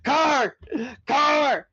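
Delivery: loud, high panicked yells; yelling. A narrator's reading. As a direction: shout the warning at the driver. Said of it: "Jake yelled".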